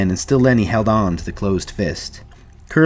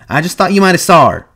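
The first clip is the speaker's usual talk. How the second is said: high, louder protest; rattled, raised-voice excuse; defensive; 9 semitones higher, 6 dB louder, faster than usual. A narrator's reading. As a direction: excuse yourself insistently. Curly excuses himself to Slim.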